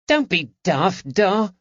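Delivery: put-on high, youthful boy's voice, irritated; the lad snapping at his dad; annoyed boy. A narrator's reading. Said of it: "Eddie said, annoyed".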